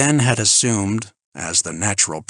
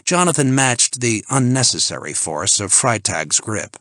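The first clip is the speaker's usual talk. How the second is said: usual speaking voice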